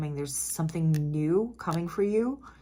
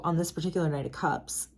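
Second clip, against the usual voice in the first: ordinary talk, nothing marked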